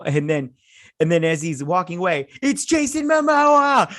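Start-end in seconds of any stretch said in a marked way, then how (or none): 2.4-3.9 high, loud, drawn-out squeal; thrilled high-pitched reveal; fangirl shriek. Real, not acted. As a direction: announce a beloved star with a scream. Excited fan announces favorite actor.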